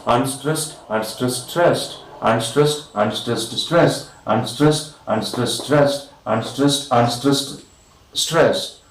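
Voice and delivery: rhythmic count rising on every "stressed"; anapaestic stress pattern. Shown as a demonstration. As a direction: tap out the meter, lifting each "stressed". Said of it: "two unstressed followed by one stressed".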